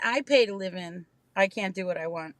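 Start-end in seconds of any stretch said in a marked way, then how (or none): none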